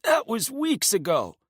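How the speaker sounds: high, breathless gasp. Acted. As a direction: gasp it out in pain and fear. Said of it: "he gasps".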